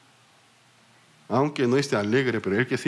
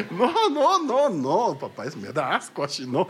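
laughing disgust; 6 semitones higher than usual. Retold or imitated. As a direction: recoil in giggles at a creepy grin. Daughter recoils at forced smile.

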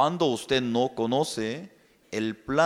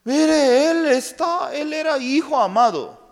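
high, loud emphatic preaching; fervent preaching; 12 semitones higher, 7 dB louder than usual. Real, not acted. Preacher proclaims Joseph as beloved son, high and loud. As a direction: proclaim it to the congregation.

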